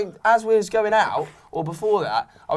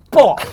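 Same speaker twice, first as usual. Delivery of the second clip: mock hit sound; 7 semitones higher, 9 dB louder than usual. Retold girl hitting him.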